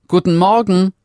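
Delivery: put-on high, loud girlish greeting; called out. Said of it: "rief es". (hoher, lauter Gruß des Mädchens in die stille Stube)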